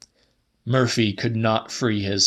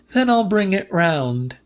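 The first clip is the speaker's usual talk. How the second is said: higher Celia; Celia's voice; 8 semitones higher than usual. A narrator's reading. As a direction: pitch up for her. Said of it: "said Celia".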